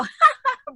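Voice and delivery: a sudden high, loud laugh of delight; burst of high laughter. Retold or imitated. Actress laughing as the fast ride ends, high and loud.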